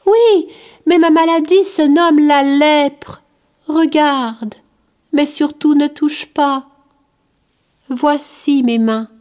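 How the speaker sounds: put-on a kind outcast reassuring a curious child; gentle leper. The leper tells the boy Curius why people flee, gently insisting. Leper shows his hands.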